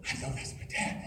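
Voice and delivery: put-on soft, breathless kid's whisper; child whispering. Son whispering to himself that he hears dad, hushed. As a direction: whisper it like a sure little boy. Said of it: "And he'd go".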